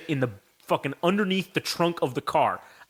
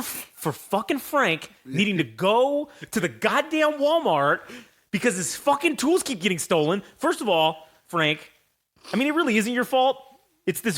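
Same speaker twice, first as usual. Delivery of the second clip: real annoyed rant; 6 semitones higher than usual. Real, not acted. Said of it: "I'm fucking angry".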